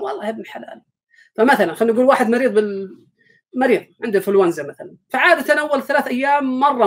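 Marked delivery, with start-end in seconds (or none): 0.0-0.8 a soft, grudging mutter. Retold or imitated. The digital-clock man answering his friend, quietly resigned. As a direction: sigh it out as if nothing ever changes.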